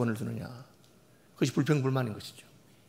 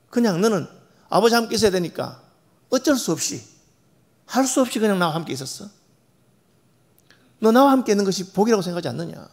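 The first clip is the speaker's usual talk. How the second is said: higher, pleading father; the father's pleading question to his older son; 6 semitones higher, 5 dB louder than usual. Acted. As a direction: coax the son like a hurt father.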